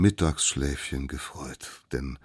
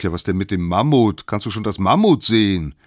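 loud, impatient group question; calling back; 4 semitones higher, 9 dB louder than usual. A narrator's reading. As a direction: shout back up to the lookout. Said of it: "die anderen riefen zurück". (laut zurückgerufen)

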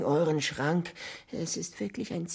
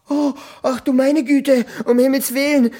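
higher, louder frantic cries; panicked alarm; 6 semitones higher, 9 dB louder than usual. Acted. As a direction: panic over a deadly danger. Stranger panics at candle.

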